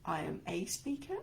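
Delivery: "a" stressed as "ay", rising questioningly at the end. Shown as a demonstration. Coach about to question the article, stress on "a". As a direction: hit "a" as a full "ay", as if querying it. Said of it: "Put the emphasis on the different words".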